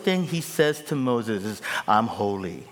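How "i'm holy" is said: a hint of divine gravity; solemn God voice. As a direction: speak as God, grave and calm. God's words, low.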